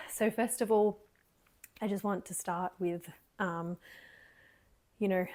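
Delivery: ordinary delivery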